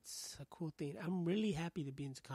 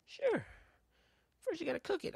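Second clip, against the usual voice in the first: squeaky-high, louder clerk voice; fast-food worker impression; 5 dB louder than usual. Worker telling customer to cook it, high mock clerk voice. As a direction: send the clerk's voice way up. Acting worker's final absurd instruction.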